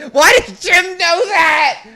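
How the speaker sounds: high, drawn-out shout of disbelief; loud comic outrage; incredulous yell. Real, not acted. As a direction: yell the question, stretching it out. Loud outburst at listener's comment.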